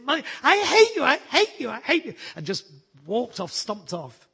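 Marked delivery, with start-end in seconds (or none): 0.1-2.1 put-on high, loud tantrum of a cross little boy; child's angry shout. Little boy shouting at his mummy in a temper. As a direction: shout it like a furious small child stamping his feet. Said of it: "he clenched his fist and he stamped his feet and he said".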